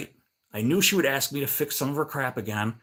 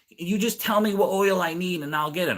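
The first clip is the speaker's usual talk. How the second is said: higher-pitched put-on neighbor voice; imitating old lady; 7 semitones higher than usual. Old lady asking neighbor about mower oil, mimicked high. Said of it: "the old lady".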